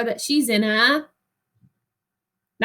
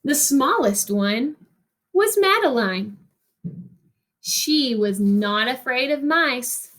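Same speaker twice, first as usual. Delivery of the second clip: put-on reading a picture book to children, rhythmic and lilting; expressive read-aloud narration; story reading. Teacher reads picture book aloud.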